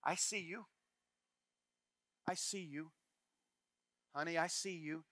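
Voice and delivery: soft, gentle reassurance to a grandchild; tender reassurance. Retold or imitated. Grandmother softly reassuring her granddaughter, tender. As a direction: lean in and soothe a small child. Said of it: "Do you know what I say?".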